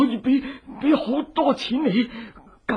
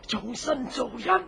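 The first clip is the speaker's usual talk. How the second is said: ordinary delivery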